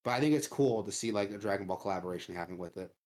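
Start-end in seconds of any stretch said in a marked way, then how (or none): 1.1-2.9 talking through hiccups; words broken by hiccups. Real, not acted. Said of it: "I got the hiccups".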